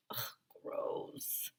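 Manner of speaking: soft whispered ugh; disgusted whisper. Acted. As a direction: breathe it out in disgust. Disgust at vomit.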